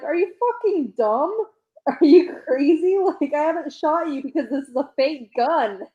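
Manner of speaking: high-pitched incredulous rant; acted exasperation. Acting character's outraged, incredulous retort.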